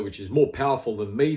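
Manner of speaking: ordinary talk, nothing marked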